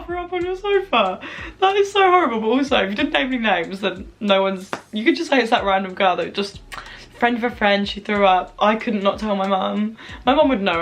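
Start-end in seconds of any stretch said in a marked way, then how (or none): none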